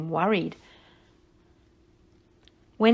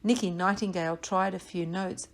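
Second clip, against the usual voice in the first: usual speaking voice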